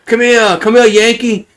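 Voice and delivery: playing a Brit waving the Yankee over, British accent; mock British accent. Brits calling the American over, mock British accent. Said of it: "Those Brits".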